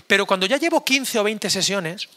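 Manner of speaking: usual speaking voice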